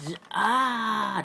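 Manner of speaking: sudden high pained cry. Acted. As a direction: cry out as the mind is seized. Psychic attack overwhelms character.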